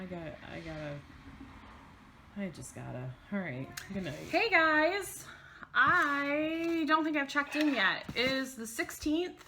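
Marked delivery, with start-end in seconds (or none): 4.3-9.4 upbeat vlog greeting. Real, not acted.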